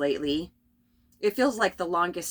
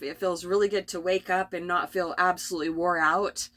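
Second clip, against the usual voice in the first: ordinary delivery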